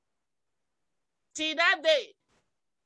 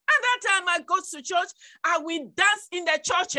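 usual speaking voice

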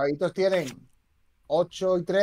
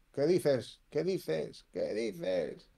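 fading disbelief; 3 semitones lower, 7 dB quieter, much slower than usual. Real, not acted. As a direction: repeat it, fading to a near whisper. Repeated disbelief fading away.

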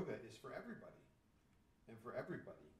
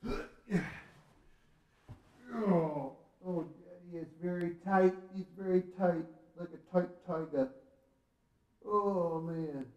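loud, higher strained voice; straining; 5 semitones higher, 12 dB louder than usual. Real, not acted. Dad stretching in front of his kids, straining loudly. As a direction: squeeze the words out mid-stretch.

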